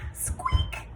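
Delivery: very high tiny squeak; mouse squeak. Acted. Mouse answers Duck.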